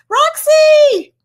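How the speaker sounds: very high loud call; high thrilled Roxy; delighted shout. Real, not acted. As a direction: shout her name in delight. Calling out to a friend.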